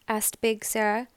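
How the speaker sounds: plain reading voice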